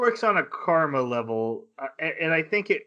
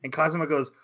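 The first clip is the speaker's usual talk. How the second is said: ordinary talk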